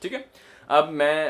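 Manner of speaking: normal talking voice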